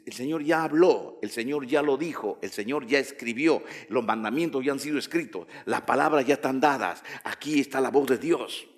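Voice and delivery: usual speaking voice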